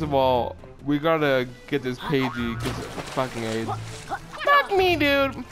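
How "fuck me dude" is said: frustrated shout. Real, not acted. Frustrated outburst at game.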